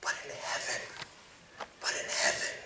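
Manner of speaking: hushed whisper, leaning in. Real, not acted. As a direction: whisper it like a secret. Hushed turn toward heaven.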